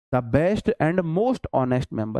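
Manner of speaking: the best and most honest member, slowly and evenly; teacher slowly reading the fixed answer to the class; slow dictation. Shown as a demonstration. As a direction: spell out the corrected phrase slowly. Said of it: "most लगा दोगे".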